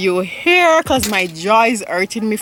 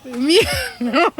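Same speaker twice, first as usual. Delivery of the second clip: higher echo broken by laughter; laughing mimicry; 7 semitones higher than usual. Teasing the learner's Jamaican, laughing mimicry. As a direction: mimic it, cracking up.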